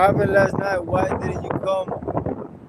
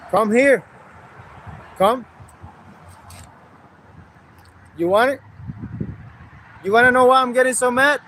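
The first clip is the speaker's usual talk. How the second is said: angry taunt; 3 semitones higher, faster than usual. Real, not acted. Heated argument on live.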